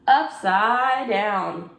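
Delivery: plain reading voice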